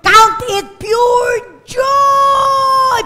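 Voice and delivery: very slow, high, loud proclamation; proclaimed word. Acted. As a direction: thunder out God's words. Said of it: "sabi ng Lord". (mabagal at malakas na pagpapahayag ng salita ng Diyos)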